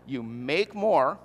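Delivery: deliberately spaced, mock-patient repetition; slow repeat. Shown as a demonstration. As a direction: repeat it slowly as if they missed it. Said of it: "let me say it once more".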